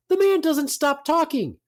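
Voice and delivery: high-pitched exasperated outburst. Real, not acted. Exasperated complaint about a talkative teacher.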